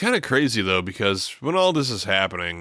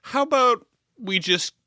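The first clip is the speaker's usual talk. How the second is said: high squeaky crazed woman, laughing at the end; a falsetto killer's offer; mock crazy lady; 4 semitones higher than usual. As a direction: ham up a sweet-voiced psycho threat. Voicing Annie threatening Paul.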